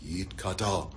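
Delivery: hushed, low response in Hebrew; repeating the prayer. Retold or imitated. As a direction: repeat it quietly, as if following someone's lead. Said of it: "Hurley's repeating every word".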